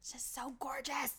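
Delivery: breathy swooning whisper. Real, not acted. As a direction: whisper it, dreamily. Swooning over actor.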